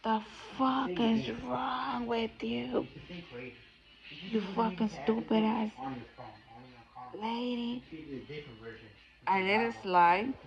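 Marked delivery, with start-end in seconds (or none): 0.0-7.8 imitating a grumpy old man yelling, pitched up and slow; mock angry rant, dragged out; hammed-up angry old man; retold yell. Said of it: "this grumpy ass man just yells and says".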